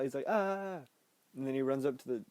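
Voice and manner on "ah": high imitated cry of alarm; mock freak-out cry. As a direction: cry out high, mimicking panic. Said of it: "He's freaking out".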